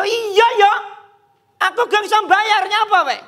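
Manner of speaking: high, loud, quick mock outburst; rude retort. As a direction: act out the debtor turning on the lender. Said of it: "Rada kasar".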